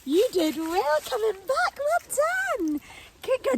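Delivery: high, slow praise; dog praise voice. Real, not acted. Owner praising her puppy in a high sing-song voice. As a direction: coo at a good puppy.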